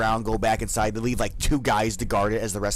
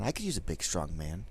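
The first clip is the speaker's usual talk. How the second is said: seductive murmur; 7 semitones lower, 11 dB quieter than usual. Acted. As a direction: purr it to tempt him. Flirting guard repeats softer.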